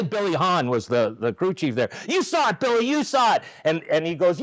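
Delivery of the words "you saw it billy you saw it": high-pitched mock protest; high, insistent complaint, acted out; retold indignant appeal. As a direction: imitate a manager appealing to the crew chief. Manager appealing to crew chief, acted.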